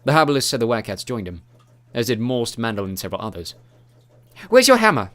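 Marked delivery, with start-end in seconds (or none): 4.5-5.1 loud call across the battle; shouting. A narrator's reading. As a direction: shout it over the clash. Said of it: "shouted Angela".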